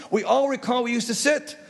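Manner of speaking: high-pitched mock insistence; arguing. Sitting faction argues with standing faction, mock insistent. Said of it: "They argued".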